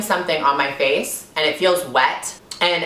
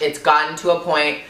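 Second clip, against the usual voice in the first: ordinary talk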